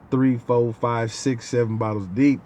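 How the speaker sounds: ordinary talk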